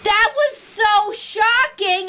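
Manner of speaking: ordinary talk, nothing marked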